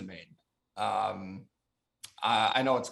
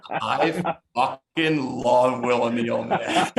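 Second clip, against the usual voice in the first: laughing fondly; 3 semitones higher, 4 dB louder, much slower than usual. Real, not acted. Gushing about a teammate, laughing.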